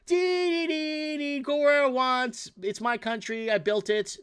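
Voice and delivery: high, mocking sing-song of the careless cyclist; high mock voice, slow on go where I want. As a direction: ham up the woman who ignores traffic.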